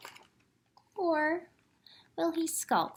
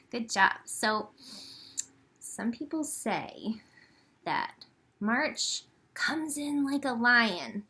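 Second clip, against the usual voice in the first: plain reading voice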